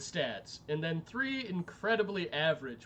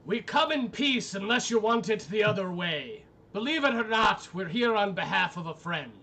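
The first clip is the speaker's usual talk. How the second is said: loud, raised proclamation; projected, amplified voice; 4 semitones higher, 6 dB louder than usual. Acted. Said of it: "cast Thaumaturgy to project my voice".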